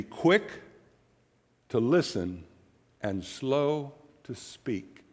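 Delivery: slow emphasis. Shown as a demonstration. Pastor quoting James to couples, slow and soft. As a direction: let it land word by word. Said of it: "quoting James slowly".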